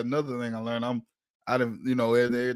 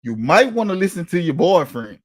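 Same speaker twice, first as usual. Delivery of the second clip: mock cop order; 5 semitones higher, 8 dB louder than usual.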